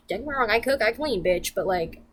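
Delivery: ordinary talk, nothing marked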